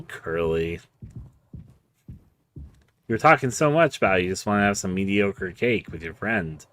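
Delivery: ordinary talk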